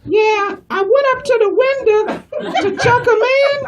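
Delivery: put-on high-pitched old lady voice; elderly woman customer. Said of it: "She's like".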